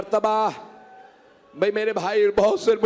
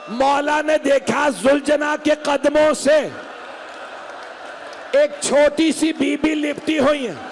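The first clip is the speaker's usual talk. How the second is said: preaching at full force; 5 semitones higher, slower than usual. Acted. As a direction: wail the story out to the gathering. Full-force lament on the horse.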